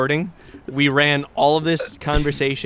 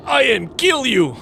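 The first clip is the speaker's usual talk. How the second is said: hammed-up Russian gangster accent, higher; mock Russian thug, pitched up; 10 semitones higher than usual. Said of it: "Doing a flawless Russian accent".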